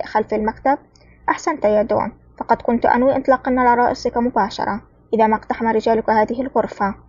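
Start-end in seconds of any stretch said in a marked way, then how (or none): none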